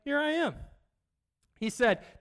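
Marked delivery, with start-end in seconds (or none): 0.0-0.5 eager, high "Here I am"; eager reply. Retold or imitated. Abraham answering God's call, eager and high. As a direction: answer God eagerly, pitched up. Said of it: "he's like".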